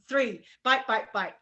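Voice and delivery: normal talking voice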